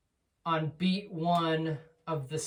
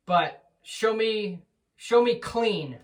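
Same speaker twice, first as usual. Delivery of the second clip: "show" and "clean" slow and higher, leaned on hard; stress on clean; 4 semitones higher, 4 dB louder, slower than usual. Shown as a demonstration. Teacher insisting to student on clean playing, slow and emphatic. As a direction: drag out "show me clean" and hit "clean". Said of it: "not fast".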